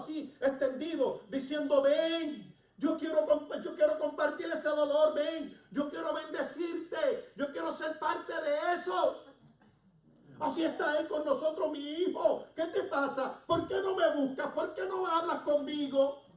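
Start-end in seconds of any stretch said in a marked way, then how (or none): 1.8-9.2 raised, high pleading call; urgent loving call. Acted. Parent to a child in need, urgent loving call. 11.7-16.2 loud, high-pitched appeal; pleading parent. Acted. God to his child who stays away, pleading parent.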